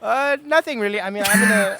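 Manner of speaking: steady British accent held on request. Shown as a demonstration. Voice actor shows the interviewer his British accent. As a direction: keep the British accent going, easy and casual. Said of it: "So now I can switch back to the New York one".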